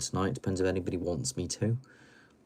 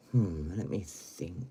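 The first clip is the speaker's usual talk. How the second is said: normal talking voice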